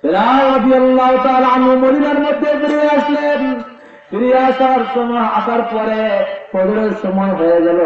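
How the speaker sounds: high intoned sermon narration; impassioned preacher's recitation; melodic preaching. Acted. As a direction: chant the story out high.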